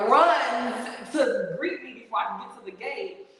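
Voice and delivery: ordinary talk, nothing marked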